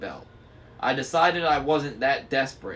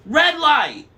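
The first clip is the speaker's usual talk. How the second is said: very high, loud shriek; scream; 11 dB louder than usual. A narrator's reading. Tempest screaming at the driver. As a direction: shriek the warning at the top of your voice.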